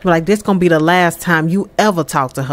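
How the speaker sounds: low, controlled threat; lightly acted stern dad laying down the law; retold warning. Dad warning the man off his daughter, low and stern. Father warns boyfriend off.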